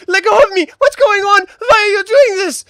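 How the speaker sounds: high-pitched, loud panicked shouting; captive's cry echoing down a corridor; desperate calling out. Acted. Hostage yells at his captor to let go, desperate shout. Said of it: "you hear this call out".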